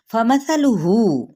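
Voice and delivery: drawn out, chanted recitation of the word; slow Quran recitation. Shown as a demonstration. Teacher reciting a Quran word for students, slow and chanted. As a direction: recite it slowly and melodically, stretching the vowels.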